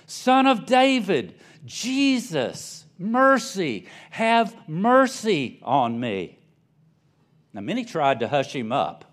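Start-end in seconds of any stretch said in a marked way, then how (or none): 0.1-6.4 high, loud desperate plea, slowing on the last words; a blind beggar calling out for mercy; crying out. A narrator's reading. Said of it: "he began to cry out".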